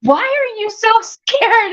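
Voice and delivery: high, laughing tease at a scared husband; teasing her husband for being scared, laughing; laughing disbelief. Retold or imitated. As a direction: laugh through the question, mocking his fear. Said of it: "And I'm going".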